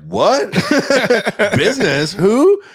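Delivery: high, loud, laughing disbelief; mock shock. Imitating his and Jay's stunned reaction to the investors. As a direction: squeal in confused surprise. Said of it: "me and Jay were like".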